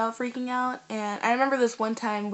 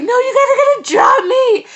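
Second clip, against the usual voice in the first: much higher and louder, yelled through laughter; laughing panicked shout; 13 semitones higher, 14 dB louder than usual. Retold or imitated. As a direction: re-enact screaming at the group below, half laughing. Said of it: "I was just like".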